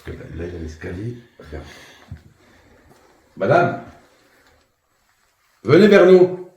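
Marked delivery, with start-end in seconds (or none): 3.4-6.5 loud summons climbing high; calling out to a spirit. Real, not acted. Ghost hunter inviting an unseen woman closer, calling out. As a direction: summon her from across the room.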